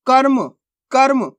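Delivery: कर्म with a half r, one short syllable, "karm". Shown as a demonstration. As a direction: say कर्म quickly as "karm", no vowel after the r. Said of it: "आधा रा का उच्चारण हो रहा है".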